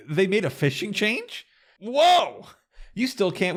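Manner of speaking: high, drawn-out mock astonishment; amazed reaction. Podcaster marvels to cohost at a fishing change, amazed. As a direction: exclaim it high, landing on a loud whoa.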